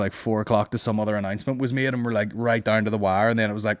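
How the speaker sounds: normal talking voice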